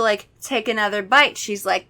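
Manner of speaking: usual speaking voice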